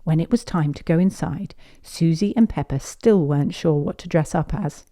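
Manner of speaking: usual speaking voice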